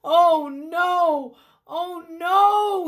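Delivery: high, loud panicked gasp of dismay; startled man realising he overslept; frightened cry. Acted. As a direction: cry out in sudden fear. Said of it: "Fear filled his pounding heart".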